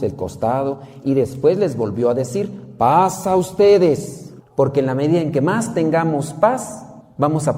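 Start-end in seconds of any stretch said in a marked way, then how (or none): 2.8-4.3 slow solemn blessing. Acted. Jesus repeats his greeting.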